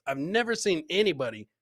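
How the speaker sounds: ordinary talk, nothing marked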